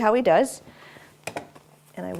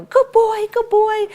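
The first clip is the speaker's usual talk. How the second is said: put-on much higher and louder, gushing good boy; big excited praise; 14 semitones higher, 8 dB louder, slower than usual. Shown as a demonstration. Trainer showing owners how to praise, excited gush. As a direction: make a huge fuss over the dog. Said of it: "make a big deal".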